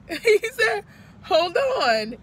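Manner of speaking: tearful repeated quote; crying out. Real, not acted. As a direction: let the sob break through the words. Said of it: "see myself crying".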